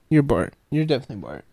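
ordinary delivery

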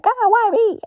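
frustrated cry to God. Retold or imitated. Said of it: "I was like".

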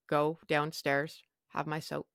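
ordinary delivery

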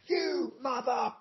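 put-on high, slow, hushed little-kid taunt; kid swearing on air. Prank caller kid swears on the radio, high and slow. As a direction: drag out the cheeky insult like a young prankster. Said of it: "and then they'd go".

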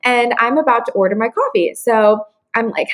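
ordinary delivery

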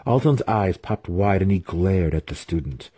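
ordinary delivery

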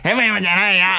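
put-on high, botched child-star caricature; 芦田愛菜. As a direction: protest in a squeaky fake kid voice. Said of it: "いや、もうヘムヘムや。". (芦田愛菜のものまねで、高い変な声)

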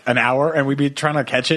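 usual speaking voice

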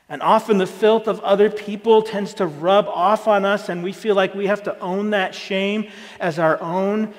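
ordinary delivery

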